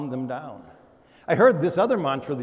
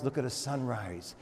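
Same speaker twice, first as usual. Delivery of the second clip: soft emphasis; 2 semitones lower, 9 dB quieter than usual. Acted. Softened repetition.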